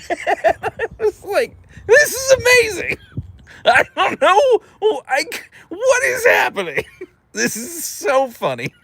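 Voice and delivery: high, giggly amazement; laughing disbelief. Retold or imitated. Retelling her delighted shock at the yelling stranger, laughing. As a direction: squeal it through laughter.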